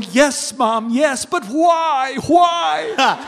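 hammed-up exasperated plea; anguished why. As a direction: cry out in comic despair. Son's mock anguish to mom.

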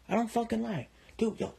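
normal talking voice

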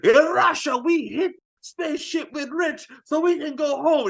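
high shouted mock Russian accent; playful mock Russian accent. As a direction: bark it in a hammed-up Russian accent. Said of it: "He's like".

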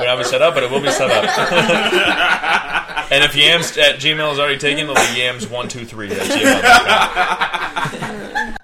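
laughing. Real, not acted. Excited plug, laughing, loud.

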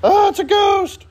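high shrieking mock panic; mock terrified scream. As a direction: shriek it like a scared kid. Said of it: "scream like girls".

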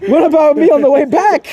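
very high, loud mock-indignant cry; outraged protest. Driver protesting to his friend about the trip back, loud outrage.

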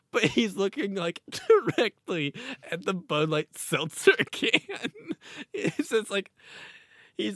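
can barely get it out for laughing; real giggling disbelief; laughing through it. Real, not acted. Cracking up at mascot photo.

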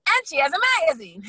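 high, loud imitation of husband blurting it out; excited blurting. Husband announcing his wife's magazine to Megan, excited blurt. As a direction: blurt it proudly over the conversation.

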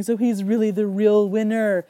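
normal talking voice

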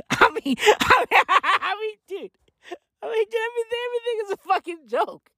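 high-pitched, breaking up with laughter; can barely get it out for laughing; incredulous laughing. Real, not acted. Cracking up at team name.

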